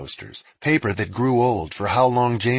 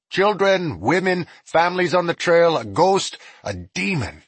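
excited storytelling; 6 semitones higher than usual. Acted. Outlaw tales, excited.

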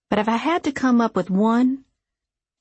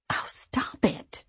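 slow playful whisper; 7 dB quieter, much slower than usual. A narrator's reading. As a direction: hush it out, embarrassed. Playful protest to teasing friend.

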